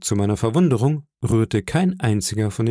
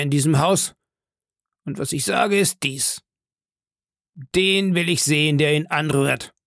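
Silver; 5 semitones higher than usual. Acted. Silver scorns and commands crew.

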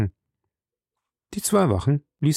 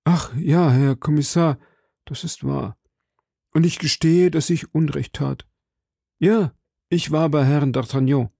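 higher, submissive confessing voice; contrite suspect; 6 semitones higher than usual. Acted. Frightened landlord admits his visit to commissioner, contrite. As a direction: own up meekly to the interrogator.

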